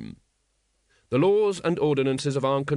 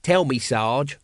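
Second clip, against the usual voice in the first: usual speaking voice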